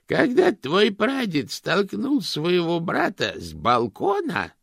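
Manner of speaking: put-on high, slow, cracked old lady. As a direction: croak like a tiny ancient aunt. Old aunt recounting.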